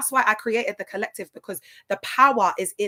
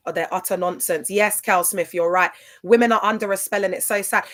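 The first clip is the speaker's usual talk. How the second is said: normal talking voice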